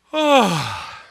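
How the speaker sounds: big drawn-out mock yawn; loud yawn. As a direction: stretch it into a long yawn.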